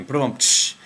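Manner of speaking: mouthed drum-and-cymbal punchline sting; spoken ba-dum, hissed cymbal crash; vocal rimshot. Pretend. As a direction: imitate a comedy rimshot with the mouth. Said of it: "with drum roll and everything".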